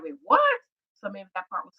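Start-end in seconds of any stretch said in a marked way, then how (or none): 0.0-0.4 retold shock. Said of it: "Jamie is shocked".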